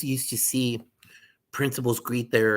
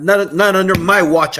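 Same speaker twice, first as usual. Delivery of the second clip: firm declaration; 6 semitones higher, 11 dB louder than usual. Acted. Principal backing a firm stance.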